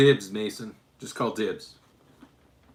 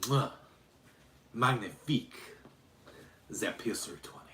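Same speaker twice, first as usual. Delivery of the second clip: mock European accent with a French flourish, trailing off softer; Baba Yaga; 6 dB quieter than usual. Streamer as Baba Yaga signing off on the pistol, hammed-up French-tinged accent. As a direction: blow a kiss, then purr the praise in a put-on European accent. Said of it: "that speaks Russian, French, German".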